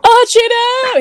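high, loud laughing scold; laughing at the sister's dog, shouting high and loud. Real, not acted. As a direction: call the dog off through laughter. Dog jumps on cohost.

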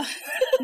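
airy high giggle with no voice; a quick breathless giggle; breathy laugh. Real, not acted. Confessing to her friend about bras, airy giggle. Admits no bra in pandemic.

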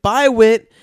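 loud, high sing-out farewell; imitated cheery holler; calling out goodbye. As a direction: call goodbye across a playground. Family calling to boy, retold.

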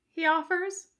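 ordinary delivery, nothing marked

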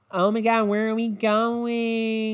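like a vacuum shutting off, dying at the end; shop vac girl voice. Retold or imitated. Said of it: "they all sound like, like a vacuum shutting off".

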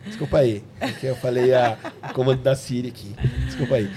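sorry, through a laugh; laughing apology. Real, not acted. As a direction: laugh it off sheepishly. Apologizing for the Siri mix-up.

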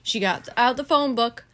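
ordinary delivery, nothing marked

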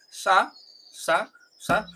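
"sa" with a hissing s, in separate short syllables; unvoiced Spanish s. Shown as a demonstration. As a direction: say "sa" with a plain Spanish s. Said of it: "pronunciar la S del portugués como". ("sa" con s sorda, sílabas sueltas)

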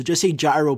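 "gyro" said JAI-roh; Americanized pronunciation. Shown as a demonstration. Greek host pleading with listeners, "gyro" Americanized as JAI-roh. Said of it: "I say gyro".

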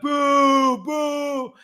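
high, loud mock boo; loud crowd booing, replayed for friends; booing shout. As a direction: boo him off the stage. Booing the speaker on stage.

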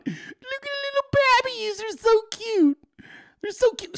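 put-on very high cooing baby talk; squealing over cute puppies, way up high. Cooing over puppy pictures.

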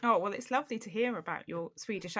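ordinary talk, nothing marked

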